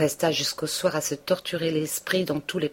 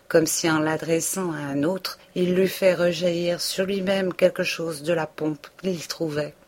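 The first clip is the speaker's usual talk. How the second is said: plain reading voice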